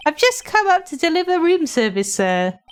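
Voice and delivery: polite bellboy. Acted. Bellboy character voice.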